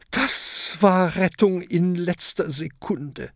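lightly hoarse little dragon; hoarse croak. A narrator's reading. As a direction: croak it out after nearly drowning. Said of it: "krächzt Oskar heiser".

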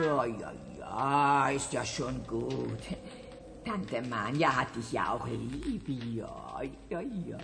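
soothing. Acted. Tante Manja soothes the kitten, soft cooing. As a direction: hush the kitten gently.

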